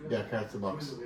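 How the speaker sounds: low, quiet deadpan delivery; flat understated mock Sean Locke; dry deadpan quote. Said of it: "the way Sean Locke opened the box and just goes".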